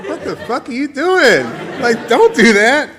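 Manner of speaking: high, loud mock protest; imitating an appalled partner, loudly; exasperated partner. Partner's appalled reaction, told laughing.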